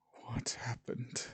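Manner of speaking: slow, whispered question; hushed concern. A narrator's reading. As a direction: whisper it slowly, as a worried character. Worried character questions Liz.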